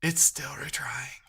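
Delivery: slow unvoiced whisper to himself. Real, not acted. Coder whispering at his screen mid-debug. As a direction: breathe it out under his breath.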